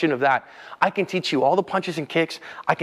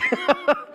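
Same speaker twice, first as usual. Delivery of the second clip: burst of laughter. Real, not acted. Laughs at own punchline.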